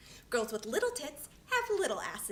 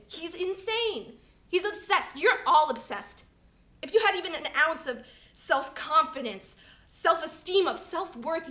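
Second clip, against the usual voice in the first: exasperated accusation; 3 semitones higher, 4 dB louder than usual. Acted. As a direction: accuse them all, voice raised. Accuses friends of obsession.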